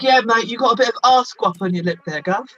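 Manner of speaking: exaggerated British mate-talk with a long "arse"; British accent. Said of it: "when you say ass and I say ass".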